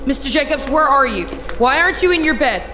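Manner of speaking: panicked nurse seeking any response; alarmed. Acted. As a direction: call out in rising alarm. Nurse calls to silent patient.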